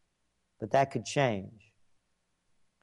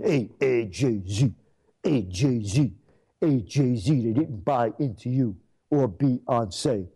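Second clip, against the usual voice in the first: mocking taunt; 3 semitones lower, slower than usual. Taunting [name] over the election loss, mock calling out. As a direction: needle him like a heckler across the street.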